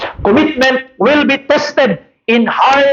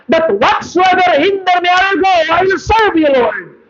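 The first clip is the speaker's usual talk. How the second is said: high-pitched, fast preaching push; 9 semitones higher than usual. Acted. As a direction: drive the congregation hard. Preaching at full force.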